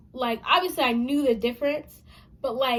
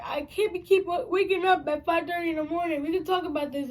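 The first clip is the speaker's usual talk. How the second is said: desperate pleading; 6 semitones higher, 3 dB louder than usual. Retold or imitated. Child begging dad not to punish.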